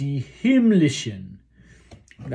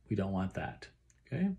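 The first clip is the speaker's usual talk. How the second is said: normal talking voice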